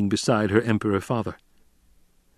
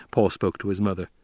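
ordinary delivery

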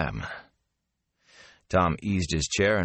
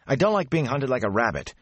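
higher, sharp angry snap; snapping; 8 semitones higher than usual. A narrator's reading. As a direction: bite the words out. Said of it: "Mat snapped".

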